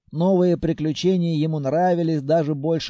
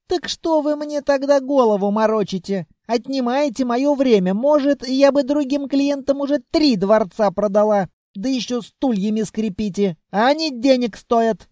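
high, louder tirade; indignant scolding; 8 semitones higher, 5 dB louder than usual. A narrator's reading. Saleswoman turns on time-wasting buyers, high indignant scolding. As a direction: scold them in a raised female voice.

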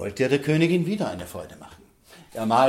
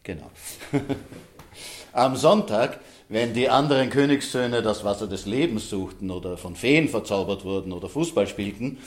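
ordinary delivery, nothing marked

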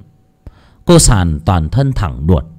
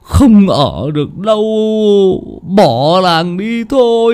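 trembling voice; 2 semitones higher, much slower than usual. Acted. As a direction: voice shaking with fear. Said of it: "mới run giọng". (run giọng sợ hãi)

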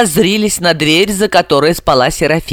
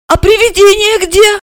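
put-on very high, piping child; high childish voice; little girl's voice; 3 dB louder than usual. A narrator's reading. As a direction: squeak a wary question like a small girl. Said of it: "спросила".

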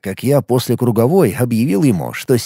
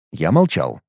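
plain reading voice

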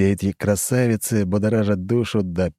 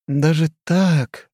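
slow, drawn-out musing, higher; thoughtful drawl; 9 semitones higher, 4 dB louder, much slower than usual. Acted. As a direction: stretch it out thoughtfully. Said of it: "задумчиво протянула Хрущева".

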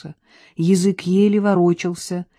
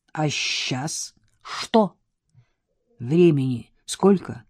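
hoarse croak; 2 semitones lower, slower than usual. A narrator's reading. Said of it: "прохрипел он".

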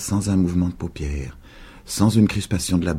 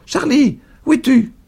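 high, louder call; 4 dB louder than usual. A narrator's reading. Old woman groping toward boy, high loud call. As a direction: call him through the clearing.

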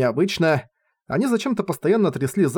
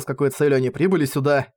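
plain reading voice

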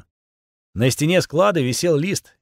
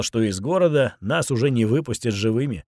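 ordinary delivery, nothing marked

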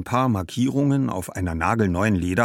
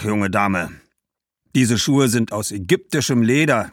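loud, raised merchant's voice; proud shoemaker; 5 semitones higher, 5 dB louder than usual. Acted. Shoemaker praising his costly shoes to a young customer, proudly. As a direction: boast about your fine goods.

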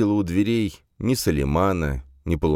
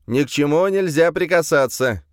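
high, louder, rote warning like a robot; robotic recitation; 11 semitones higher, 4 dB louder than usual. A narrator's reading. Gendarme warning visitors not to touch anything, robotic recitation. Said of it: "произнёс он заученно, словно робот".

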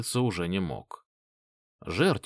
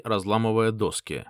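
ordinary delivery

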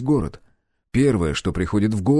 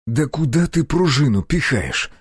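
slow, exasperated scolding, raised pitch; irritated yell; 5 semitones higher than usual. A narrator's reading. Narrator as gun instructor scolding his pupil, irritated. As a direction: snap in irritation, drawing the words out. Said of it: "раздражённо крикнул я".

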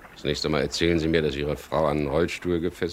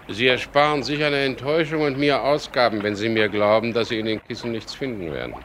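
higher, louder, coolly ironic warning; 8 semitones higher, 4 dB louder than usual. Acted. Skipper catching a thief in his cabin, coolly warning. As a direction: warn the intruder with calm irony. Catches intruder slashing cushions.

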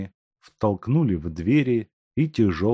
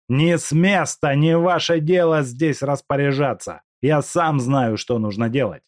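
angry command; 7 semitones higher, 5 dB louder than usual. Acted. Куликов приказывает, злится.

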